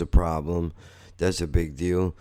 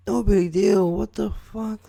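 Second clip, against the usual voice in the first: mocking disbelief; 13 semitones higher, 4 dB louder than usual. Mocking the friend's casual remark.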